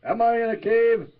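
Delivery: loud call into a cave. Pretend. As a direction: call out as if it echoes. Calling out into a cave.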